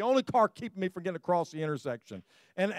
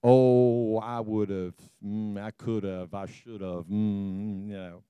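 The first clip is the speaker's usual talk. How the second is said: mumbled regret; 9 semitones lower, slower than usual. Retold or imitated. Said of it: "you tell the wife".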